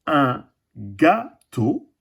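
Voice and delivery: gâteau drawn out very slowly; slow teaching word. Shown as a demonstration. A teacher showing the class gâteau, very slowly. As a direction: stretch un gâteau for the children. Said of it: "Et le dernier dessin".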